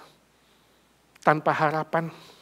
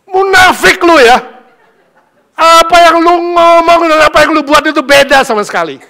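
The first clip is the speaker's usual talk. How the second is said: high, loud, furious yell; furious shout; 10 semitones higher, 15 dB louder than usual. Acted. Angry mother screams at the hypocritical man, furious. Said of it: "Langsung dia marah".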